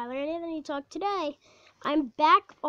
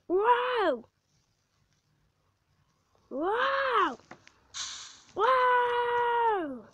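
thrilled; 8 semitones higher, much slower than usual. Real, not acted. Coaster thrill.

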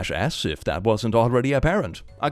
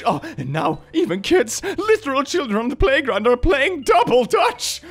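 hammed-up outrage, climbing higher; mock moral panic; 10 semitones higher than usual. Nationalist ranting that the Dutch reach the children, mock hysterical. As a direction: build from indignation to high-pitched horror.

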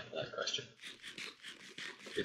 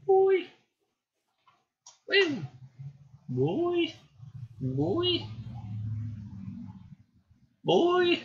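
high-pitched calling; much slower than usual. Pretend.

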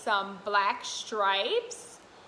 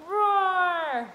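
louder mock lion roar; 7 semitones higher, 6 dB louder than usual. Shown as a demonstration. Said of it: "Can you roar like a lion".

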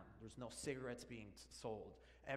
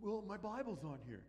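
mock adult excuse; 5 semitones higher than usual.

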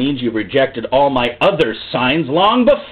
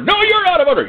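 high-pitched mock outrage; hammed-up quarrelling cry; indignant retort; 13 semitones higher than usual. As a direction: fling the accusation back, voice shooting up. Acted quarrel over who is out of order.

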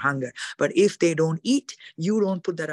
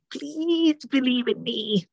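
high, slow, pleading whine; mock desperate plea; 8 semitones higher, much slower than usual. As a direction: beg like a needy preacher. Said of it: "God is not gonna come behind you and say".

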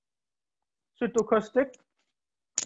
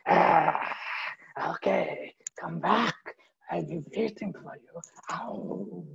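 wolf whisper; 3 semitones lower, 4 dB quieter, slower than usual. Acted. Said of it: "I am a wolf".

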